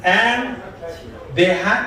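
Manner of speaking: ordinary delivery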